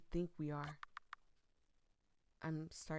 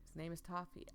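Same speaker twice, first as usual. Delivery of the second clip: normal talking voice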